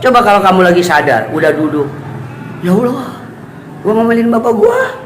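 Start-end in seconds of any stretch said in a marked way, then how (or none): 2.6-5.0 high-pitched acted dismay; mock-horrified self-reproach; dismayed realisation. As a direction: play sudden regret, pitched way up. Acts out child realising the mistake.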